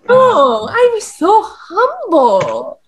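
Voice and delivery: slow, high, hammed-up humility; mock humble. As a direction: drawl out fake humility. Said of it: "Dante's like".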